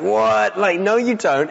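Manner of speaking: high-pitched mock disbelief; playfully incredulous contradiction; incredulous reaction. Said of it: "maybe your reaction is like".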